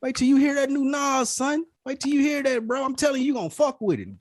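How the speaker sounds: high-pitched, hyped-up mock greeting; acted enthusiasm, pitched well up; gassing someone up. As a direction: play the buddy hyping up a new record. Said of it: "Gassing him".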